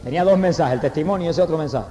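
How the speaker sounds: ordinary talk, nothing marked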